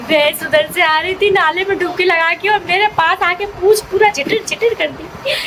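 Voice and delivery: a high, amused retelling to the viewers; high-pitched telling. Real, not acted. Comic buffalo mishap told high.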